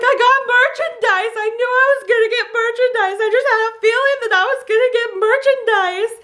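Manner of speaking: very high, emotional gushing; overjoyed squeal. Retold or imitated. Student logging in to see her role, ecstatic squeal. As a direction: squeal it, acting out happy hysterics. Re-enacted tearful excitement at role.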